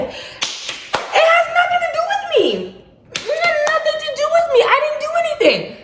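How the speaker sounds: high, pleading panicked voice; mock-scared high whine; panicky protest. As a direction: squeal your innocence in a panic. Panicked self-defense, high pitch.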